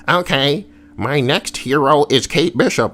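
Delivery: high-pitched pitch from a clueless developer; mock game developer. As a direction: lightly imitate someone announcing the next hero. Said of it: "when it seems like somebody's like".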